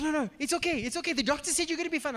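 high, hurried imitation of a comforter; anxious reassurance. Friend comforting a crying patient, high and flustered.

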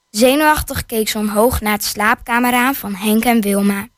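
ordinary delivery

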